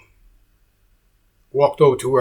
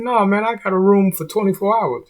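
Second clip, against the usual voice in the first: higher, louder indignant protest; 10 semitones higher, 3 dB louder than usual. Retold or imitated. Guest protesting to the desk clerk, indignant and higher. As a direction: argue back, stunned at the bill.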